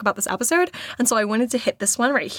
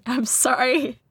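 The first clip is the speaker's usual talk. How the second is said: normal talking voice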